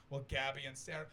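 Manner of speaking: ordinary talk, nothing marked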